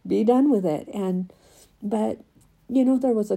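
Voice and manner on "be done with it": louder, firm retold command; mock the attorney telling her to drop it, loud and firm; attorney's blunt order. Attorney telling her to forget the exhibit, loud and firm.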